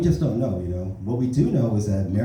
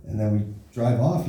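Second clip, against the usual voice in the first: ordinary delivery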